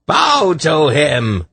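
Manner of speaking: high, loud, drawn-out order; shrieked command. A narrator's reading. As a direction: shriek it slowly at the crowd. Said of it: "Irvan shrieked".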